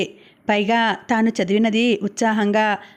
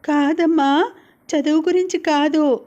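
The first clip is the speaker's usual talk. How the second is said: put-on higher-pitched child's voice; upset young daughter; 5 semitones higher than usual. A narrator's reading. Hurt child answers her mother.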